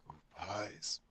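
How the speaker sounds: whispered craving over the pies; hushed greedy whisper. Pretend. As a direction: breathe it out like a treat is in sight. Player craving pies, breathy aside.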